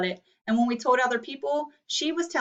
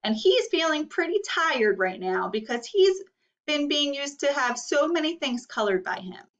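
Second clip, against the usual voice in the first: usual speaking voice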